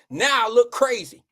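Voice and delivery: ordinary talk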